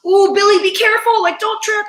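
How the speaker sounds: high, loud mock concern from a crowd; crowd calling out. Fans calling to [name] on the stairs, high worried call. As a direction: call up to the stage like a worried fan. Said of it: "everyone in the crowd goes".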